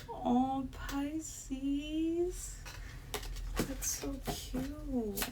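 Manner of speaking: soft, high, drawn-out coo; tender, slow aww voice; touched cooing. Real, not acted. Cooing over a sweet card message.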